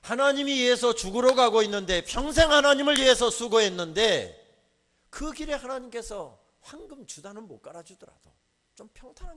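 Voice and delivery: loud, forceful sermon cry; a loud preacher's challenge; fiery preaching. Acted. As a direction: hammer the question at the congregation.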